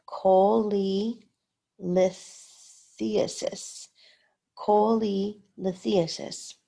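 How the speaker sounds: cholelithiasis said very slowly, syllable by syllable; slow struggle through cholelithiasis; sounding it out. Shown as a demonstration. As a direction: drag out cholelithiasis, feeling for each syllable. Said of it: "I was struggling with some of the pronunciations".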